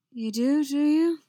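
flustered loud echo-question; nervous reply. A narrator's reading. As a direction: blurt it back, rising at the end. Bard nervously reacting to confession.

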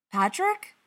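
high, loud, rising question; happy surprise. A narrator's reading. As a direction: perk up brightly on the name. Bob happy to hear Patrick.

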